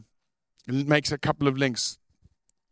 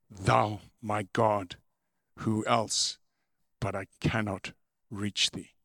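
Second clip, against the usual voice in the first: muffled; 4 semitones lower, 5 dB quieter, slower than usual. A narrator's reading. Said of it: "in a muffled voice".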